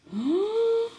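high, sharp shocked gasp; dumbfounded gasp. A narrator's reading. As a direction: gasp at the wrecked kitchen. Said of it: "Riep hij verbijsterd". (verbijsterd happend naar adem)

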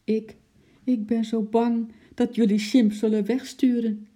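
ordinary delivery

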